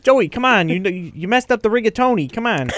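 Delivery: high, exasperated put-on New York accent; mock New Yorker. Co-host scolds Joey over rigatoni, mock New York accent. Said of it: "everybody in New York is named Joey".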